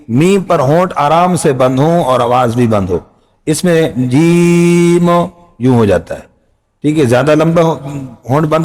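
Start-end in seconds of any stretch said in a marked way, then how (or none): 3.5-5.1 جیم held long and level on one pitch; جیم sung long, level and steady; drawn-out jeem. Shown as a demonstration. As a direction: stretch جیم evenly, no change. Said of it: "جی کی sound ہونی چاہیے اور بالکل برابر".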